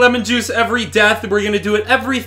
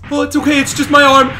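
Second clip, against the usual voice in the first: ordinary talk, nothing marked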